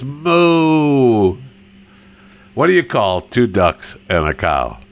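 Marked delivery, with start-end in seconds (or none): 0.0-1.4 high, long-held moo; mock cow moo. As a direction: stretch out a high cartoon moo. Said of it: "A cow says".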